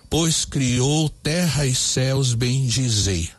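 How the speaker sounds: very slow, every syllable spaced out; measured scansion read; syllable counting. Shown as a demonstration. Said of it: "vamos contar".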